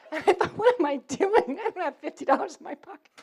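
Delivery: high-pitched, laughing self-disbelief; laughing through words. Real, not acted. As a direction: crack up while telling your own thought. Laughs while retelling her own panicked thought.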